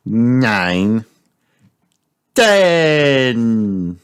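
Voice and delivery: drawn-out numbers with long pauses; counting aloud. A narrator's reading. Peppa seeking in hide and seek, counting aloud. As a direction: count slowly, stretching each number to the end. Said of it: "Peppa covers her eyes and counts".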